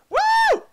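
high, loud woo; shrill-pitched celebratory whoop; excited whoop. Real, not acted. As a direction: let out a piercing cheer. Cheering the stunt.

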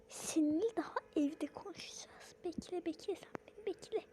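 whispered, soft threat; threatening whisper. Acted. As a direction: hiss a warning under your breath.

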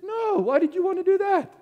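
high, loud, mock-puzzled reply; mock innocent reply. Pastor teasing the students with a high mock-puzzled reply. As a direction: play dumb with a lifted voice.

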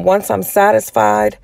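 ordinary delivery

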